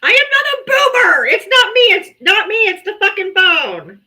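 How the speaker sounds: high, loud frustrated protest; exasperated shout. Real, not acted. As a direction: yell it at the broken phone, fed up. Frustrated at phone, denies boomer.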